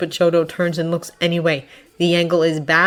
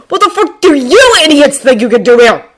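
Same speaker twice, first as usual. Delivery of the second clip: loud, high, exasperated challenge; gritted-out anger; 8 semitones higher, 11 dB louder than usual. A narrator's reading. Bakugo berates classmates for coming, gritting it out. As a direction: angrily challenge them, teeth gritted. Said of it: "he grits out at him".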